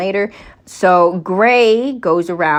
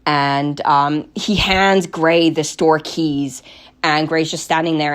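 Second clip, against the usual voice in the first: ordinary delivery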